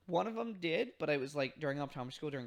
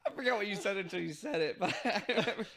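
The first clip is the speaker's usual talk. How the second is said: talking through real laughter; words bubbling with laughter; laughing; 6 semitones higher, 6 dB louder than usual. Real, not acted. Both laughing at the memory.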